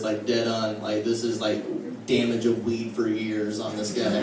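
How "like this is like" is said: ordinary talk, nothing marked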